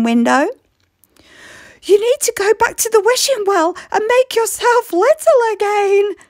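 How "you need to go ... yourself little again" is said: high, firm motherly voice; Mum's voice. Acted. Mum tells giant Ellie to shrink, high and firm. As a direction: send her off like a busy mum. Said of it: "said Mum".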